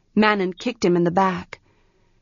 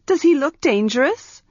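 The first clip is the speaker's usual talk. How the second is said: scoffing; 7 semitones higher than usual. Acted. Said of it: "Arha scoffed".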